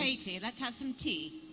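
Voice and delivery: slow, put-on British accent offering tea; a light British tea-party voice. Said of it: "do a British accent".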